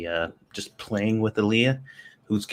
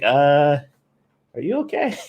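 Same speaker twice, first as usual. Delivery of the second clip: higher, hesitant mock-puzzled question; retold bewildered concern; 4 semitones higher than usual. Parent retells reacting to baby's hysterical laughing, bewildered. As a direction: imitate asking warily after someone acting oddly. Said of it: "I'm like".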